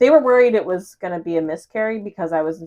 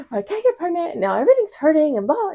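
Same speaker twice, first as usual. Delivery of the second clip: higher, fast panicky rush; freaking out; 9 semitones higher, faster than usual. Retold or imitated. Retelling pouring her worries out to the doctor, panicky and high. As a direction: spill it out like you're retelling your own panic. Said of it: "I'm freaking out".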